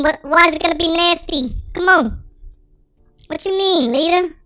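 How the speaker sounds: ordinary talk, nothing marked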